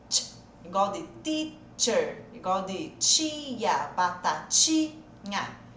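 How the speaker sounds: teacher and tia said very slowly with a tch sound; ch sound demo. Shown as a demonstration. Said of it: "O som é". (som de ch bem devagar)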